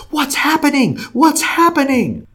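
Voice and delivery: very high, imitated panicky disbelief; freaking out. Healed woman startled by her moving body, freaking out. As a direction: mimic someone freaking out, voice shooting way up. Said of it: "she's freaking out".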